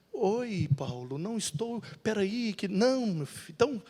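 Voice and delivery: voz bem mais baixa, hesitante e sonhadora; Marcos hesitante e baixinho. Acted. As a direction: como um escritor distraído que não quer viajar. Marcos hesitante responde a Paulo. (Marcos responde a Paulo baixinho, hesitante)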